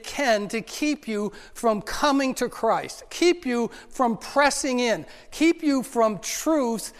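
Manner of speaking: slow, hammered repetition of keep; slow emphatic sermon build; preaching emphasis. Real, not acted. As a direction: drive each keep home from the pulpit.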